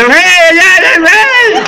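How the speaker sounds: high shouted hey hey; high frantic hey shouts; calling out. Acted. Calling out in story.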